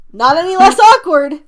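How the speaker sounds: a loud laugh breaks up the line; laughing through words. Real, not acted. Laughing at awkward text.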